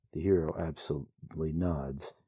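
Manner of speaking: usual speaking voice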